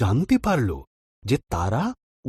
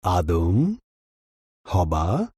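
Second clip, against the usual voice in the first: lower, solemn calling voice; God calling; 6 semitones lower, much slower than usual. A narrator's reading. God calling for Adam and Eve, low and grave. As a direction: call them out like a grave deity. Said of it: "ঈশ্বর ডাকলেন".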